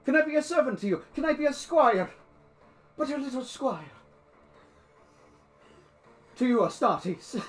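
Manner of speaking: high, meek begging voice; pleading old man. Acted. Old man asks the Astartes to take him as servant, meek plea. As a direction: beg humbly to be taken along. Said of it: "He's like".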